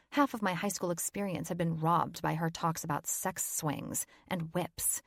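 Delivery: ordinary delivery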